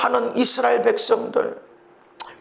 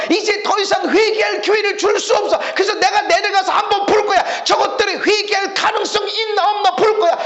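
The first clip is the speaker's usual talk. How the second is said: God's thundering voice; 9 semitones higher than usual. Acted. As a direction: proclaim God's patience is spent. God declaring he'll judge. (인내가 끝난 하나님의 외침)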